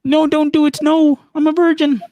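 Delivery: very high-pitched, stretched mock plea; loud, high put-on meme voice; imitating chat. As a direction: squeal it out as a mock protest from chat. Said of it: "That's probably what they're saying".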